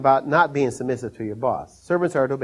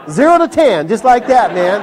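higher and louder, laughing through it; 8 semitones higher, 8 dB louder than usual. Real, not acted. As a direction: laugh out the words. Laughing retelling the scare.

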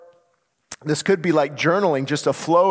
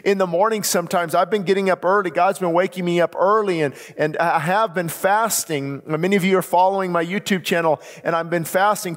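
ordinary talk

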